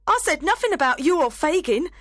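very high, loud, fast pleading; begging for life. Acted. Nancy begging Sykes not to kill her, frantic. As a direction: beg desperately, rushing the words.

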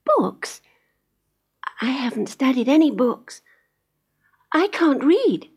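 put-on high, innocent young girl; little girl voice. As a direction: simply admit it like a child. Heidi answers, higher voice.

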